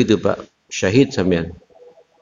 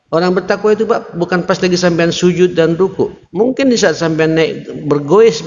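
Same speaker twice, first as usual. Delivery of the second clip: higher, ringing sermon voice; fiery preaching; 8 semitones higher than usual. Acted. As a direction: exhort the congregation with rising urgency. Preaching at full force.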